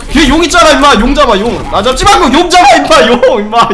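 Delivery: loud, high shout at teammates; frustrated shout. Real, not acted. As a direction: yell the warning. Yelling at teammates.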